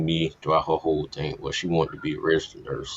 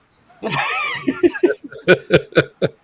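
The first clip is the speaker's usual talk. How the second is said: loud howling laugh; 5 dB louder than usual. Real, not acted. Cracks up at own joke.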